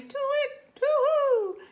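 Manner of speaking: high, loud hooting owl call. Acted. Owl hooting in alarm before flying away. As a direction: hoot like a startled owl.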